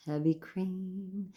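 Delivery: drawn-out, soft musing; savouring. Real, not acted. Vlogger dwelling on a favorite food, savouring it slowly. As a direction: linger softly over the words. Savouring the item just named.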